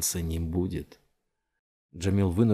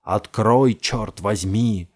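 much louder, slow furious shout; 8 dB louder, much slower than usual. A narrator's reading. Jamil yelling through the door, loud and slow. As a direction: bang on the door and yell. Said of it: "прокричал Джамил".

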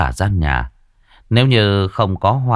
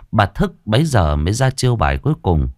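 plain reading voice

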